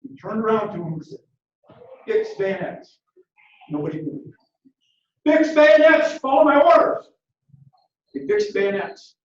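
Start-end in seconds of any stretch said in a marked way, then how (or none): 5.2-7.1 high, loud barked command; commanding shout. Retold or imitated. Colonel repeats his order to men who didn't move, shouting. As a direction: bellow the order so the whole line hears.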